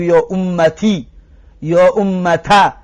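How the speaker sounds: «یا امتی» then «یا امتا», each slow, the final vowel changed; slow contrast of the final vowel; vowel variants demo. Shown as a demonstration. Teacher listing the forms for students, slow.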